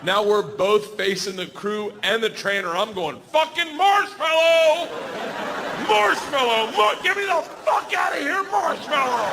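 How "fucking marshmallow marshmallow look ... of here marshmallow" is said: frantic shout for rescue, much higher; panicked shouting. Retold or imitated. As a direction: scream at the crew to get you out. Screaming at crew for help.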